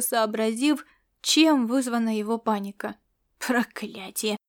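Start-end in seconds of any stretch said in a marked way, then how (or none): 3.4-4.4 тихое ругательство на выдохе; выдохни это тихо, вполголоса. A narrator's reading. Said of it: "выдохнул Ласло". (тихо, на выдохе)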